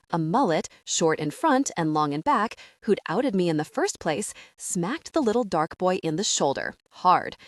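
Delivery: ordinary delivery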